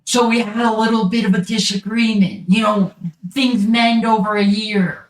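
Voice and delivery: fast, breezy dismissal; brushing it off quickly. Acted. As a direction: rattle it off like it's nothing. Father repeating, brushing it off.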